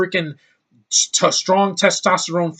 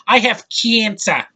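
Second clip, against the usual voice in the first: put-on higher, drawn-out Australian accent; mock Australian accent; 6 semitones higher, 3 dB louder, slower than usual. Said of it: "talk like that with an Australian accent".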